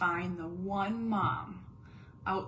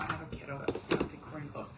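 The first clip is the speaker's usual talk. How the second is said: usual speaking voice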